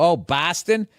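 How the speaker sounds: Midwestern Boston with flat o; Midwest accent. Retold or imitated. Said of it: "that's how people from the Midwest say it".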